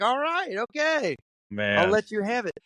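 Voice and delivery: high, playful catchphrase-style okay; Arthur Fonzarelli. Retold or imitated. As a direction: imitate a cool guy conceding with gusto. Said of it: "like a Arthur Fonzarelli kinda".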